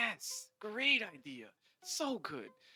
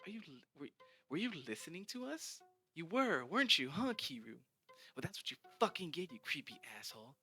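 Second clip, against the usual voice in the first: accusing sneer; 6 semitones higher, faster than usual. Acted. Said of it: "Dina says".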